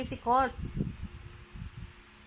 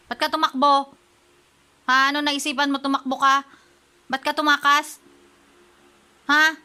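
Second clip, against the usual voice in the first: louder, higher pretend telling-off; mock scolding; 4 semitones higher, 8 dB louder than usual. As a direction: scold the runaway, hammed-up. Scolding pet who ran away. (pinapagalitan ang tumakbong alaga, kunwaring galit)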